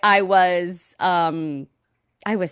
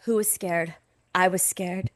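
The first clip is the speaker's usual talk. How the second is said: quoting a TikTok; 5 dB quieter than usual. Retold or imitated. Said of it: "You know that TikTok?".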